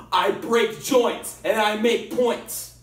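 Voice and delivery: slow, loud, punched catchphrase; slow punchy catchphrase. Acted. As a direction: hammer each word home. Wrestler's catchphrase threat.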